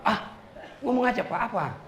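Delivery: a hint of a cheeky boy; mock teen son. Son voiced higher.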